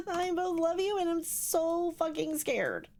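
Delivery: high, rattled wail after a scare; scared and shaken. Real, not acted. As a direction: blurt it high through panicky laughter. Jump scare in horror game.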